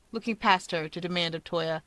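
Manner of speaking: ordinary delivery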